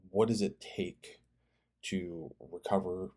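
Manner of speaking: ordinary delivery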